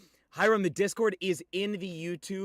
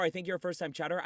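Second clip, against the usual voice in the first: ordinary talk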